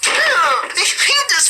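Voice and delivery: put-on high, strained sulky kid's yell held back; suppressed yell. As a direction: yell back so parents won't hear. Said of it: "yell back at them, but you don't want them to hear".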